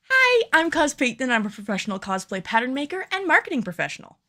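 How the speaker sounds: bright upbeat host read; cheerful on-camera presenter voice; presenter intro read. Acted. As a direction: sell the intro like a YouTube host. Retake of the intro script.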